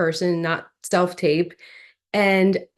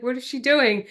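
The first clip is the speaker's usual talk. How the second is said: puzzled; 4 semitones higher than usual. Retold or imitated. Casting panel puzzled.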